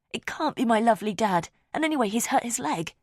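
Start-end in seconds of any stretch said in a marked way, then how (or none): none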